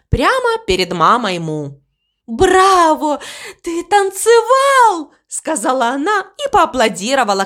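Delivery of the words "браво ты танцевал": high, drawn-out cheer; delighted applause. A narrator's reading. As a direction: acclaim the dancer, stretching the words high. Cow applauds the crow's dance.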